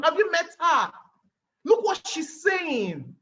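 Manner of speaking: impressed calling out. Retold or imitated. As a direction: show her off with a slow shout. Engineer impressed, calling his team.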